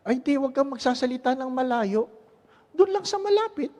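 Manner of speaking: high-pitched acted counsel; advising priest. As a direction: give advice in a high, softly projected voice. Priest advising a confessing husband.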